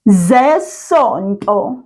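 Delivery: very slow, every syllable clear; over enunciating. Shown as a demonstration. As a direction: spell out zesonto slowly. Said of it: "your turn".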